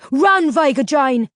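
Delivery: loud, slow yell; urgent shout; yelled warning. A narrator's reading. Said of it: "grabbed my arm and yelled".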